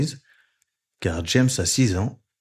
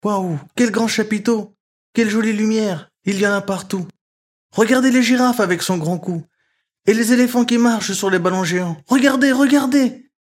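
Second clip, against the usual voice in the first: put-on high, excited child's wonder; little frog marvelling at the circus; amazed; 10 semitones higher, 4 dB louder than usual. A narrator's reading. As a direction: gasp at everything, dazzled and thrilled. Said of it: "James est ébloui".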